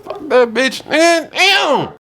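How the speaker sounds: high, loud shouted cheer; hyped shout. Real, not acted. Echoing friend's outburst.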